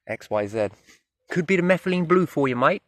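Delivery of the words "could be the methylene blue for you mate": higher-pitched, accidental Australian lilt; Australian accent. Real, not acted. Walker jokes about methylene blue in the river, Australian accent. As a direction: slip into an Australian accent on a rising tune. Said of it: "Don't know why it sounded Australian".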